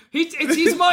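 usual speaking voice

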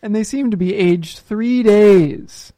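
milk each word like a detective; dramatic verdict. Pretend. Mock forensic verdict.

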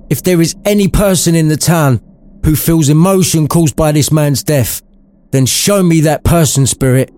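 put-on high, pained old man's voice; agonized Scrooge. A narrator's reading. Scrooge pleads in agony with the spirit, high-voiced. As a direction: beg in anguish. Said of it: "agonized Scrooge".

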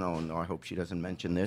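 low, very soft anxious mutter. Retold or imitated. Grandfather dreading the teacher's scolding at school night, anxious low mutter. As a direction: mutter it to yourself, dreading a mention. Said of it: "I'm shaking back and forth".

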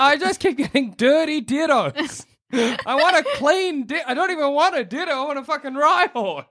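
high-pitched whining trainer; high whiny complaint. Acted. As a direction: whine it high and exasperated. Whiny trainer complaining about Dittos.

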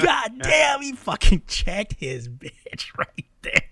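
high, loud start easing off slowly; acted-out disbelief; amazed outburst. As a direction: hoot in astonishment, then draw it out laughing. Teller's amazed reaction, retold.

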